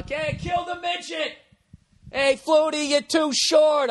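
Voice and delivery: high mocking stadium heckle, stretched out slowly; retold heckling yell. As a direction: jeer at the short quarterback like a rowdy fan. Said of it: "They yell".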